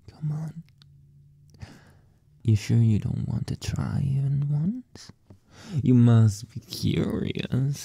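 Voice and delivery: usual speaking voice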